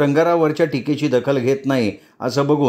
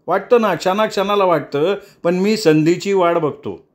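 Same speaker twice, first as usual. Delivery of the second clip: a higher, lightly voiced threatening young man; Jatin's threat; 7 semitones higher than usual. Jatin warning the leader, a light threatening tone. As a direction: warn him coolly, as a threat.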